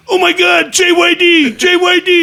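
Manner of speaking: high, loud mock fan freak-out; starstruck shouting. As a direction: shriek like an overjoyed fan spotting an idol. Starstruck dealer recognizes wrestler.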